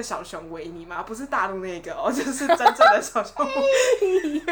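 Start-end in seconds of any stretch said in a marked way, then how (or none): 2.1-4.5 higher, louder, slow and laughing on 真正的小熊维尼; laughing emphasis. Real, not acted. Friend laughs insisting she means the real Pooh bear. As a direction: stretch out 真正的小熊维尼 while giggling.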